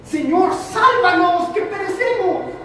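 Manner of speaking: high, slowed cry for rescue; frantic storm plea; desperate plea. Acted. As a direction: beg the master to save them from drowning. Disciples crying out in storm.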